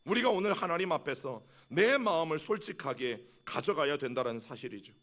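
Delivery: usual speaking voice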